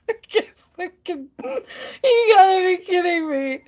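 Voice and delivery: high, tearful squeak through laughter; choked-up, high laughing disbelief; real cry-laughing. Real, not acted. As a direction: barely get the words out for laughing. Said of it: "I'm cry laughing".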